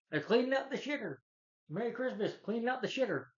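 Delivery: hammed-up cheery call across the yard; hollering Cousin Eddie. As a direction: call it out high, in a goofy character voice. Acts Eddie calling out to neighbours.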